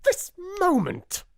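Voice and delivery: high, loud fierce order. Acted. Miss Minchin orders her dithering sister, fierce. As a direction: snap the order. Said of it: "was the fierce answer".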